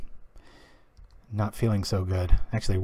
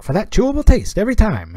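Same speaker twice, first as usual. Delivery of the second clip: a higher, louder radio ad pitchman from the twenties; old-timey vaudeville voice; 9 semitones higher, 6 dB louder than usual. Retold or imitated. Retelling an improvised radio ad for his brother, hammy vaudeville voice. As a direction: sell it like an old-time vaudeville announcer. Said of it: "I was doing this old-timey vaudeville voice".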